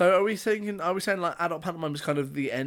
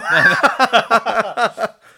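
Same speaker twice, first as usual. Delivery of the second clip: hearty laugh; loud laugh; 6 semitones higher, 9 dB louder than usual. Real, not acted. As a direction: let out a big laugh.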